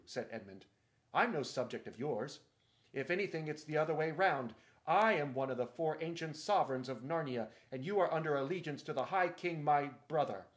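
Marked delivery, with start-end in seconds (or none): 1.1-10.5 loud, proud defiance; indignant retort. Acted. Edmund rebuking Caspian's claim, loud indignant retort. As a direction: put the upstart king in his place.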